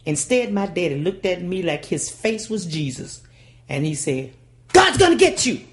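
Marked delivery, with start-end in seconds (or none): 4.7-5.6 much higher, louder threat; daddy's warning. Retold or imitated. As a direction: warn her like doom.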